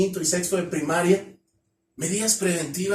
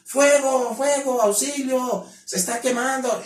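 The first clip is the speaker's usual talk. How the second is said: shouting fire; 6 semitones higher than usual. Shown as a demonstration. Speaker shows the kids what to yell when threatened. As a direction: yell fire to turn heads. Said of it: "se me ocurre gritar".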